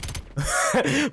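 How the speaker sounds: high burst of laughter; amused laugh. Real, not acted. Storyteller cracking up at own cheeky reply, high laugh. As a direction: laugh at your own punchline.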